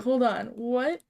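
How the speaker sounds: usual speaking voice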